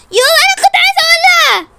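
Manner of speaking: high, loud burst; excited outburst. Pretend. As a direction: blurt it out in a rush. Girl protests loudly.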